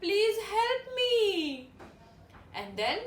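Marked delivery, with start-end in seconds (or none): 0.0-1.1 high, teary plea through tears; a lost little hippo sobbing for mama; crying. A narrator's reading. Lost hippo tells the animals he wants home, crying. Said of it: "He started crying and said".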